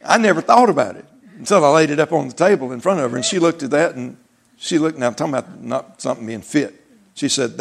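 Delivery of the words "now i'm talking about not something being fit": ordinary delivery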